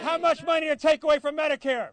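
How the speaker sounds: shouted call for a crowd's response; rally shout. Real, not acted. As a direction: fire the question out to rouse a crowd.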